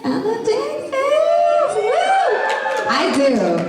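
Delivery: high, thrilled whoop for Texas; excited cheer. Real, not acted. On a fan panel, cheering her home state, excited.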